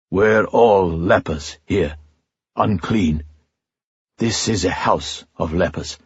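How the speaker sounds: a hint of a weary old leper, slowing down. A narrator's reading. Leper repeats, slower, fading.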